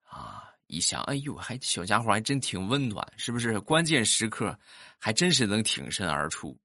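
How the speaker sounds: normal talking voice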